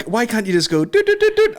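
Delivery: high, squeaky doot doot sounds; exasperated robot beeps at the router; mock beeping plea. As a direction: beep it out like a wee machine. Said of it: "and then I'm like".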